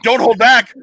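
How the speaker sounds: loud, high urging shout; acted coach's yell. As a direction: yell it like a coach egging on a fighter. Acting out the coach urging Tommy.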